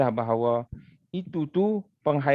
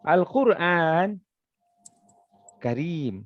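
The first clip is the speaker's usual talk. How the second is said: Al-Quran stretched slowly, long pause, karim falling; slow Al-Quran set apart from karim; pause after the subject; much slower than usual. Shown as a demonstration. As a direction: draw out Al-Quran, wait, drop to karim. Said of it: "Contohnya macam ni".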